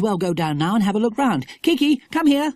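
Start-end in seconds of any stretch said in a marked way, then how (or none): 1.6-2.5 high, loud call to a pet; raised, high-pitched summons. Acted. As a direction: call the bird over sharply. Jack calls his parrot over.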